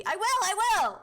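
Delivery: high, loud mock scream; playful protest shout. Streamer yelling at chat about taking meds, playful shout. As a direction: yell it back in exaggerated protest.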